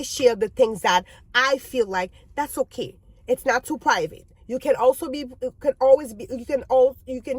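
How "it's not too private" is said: ordinary delivery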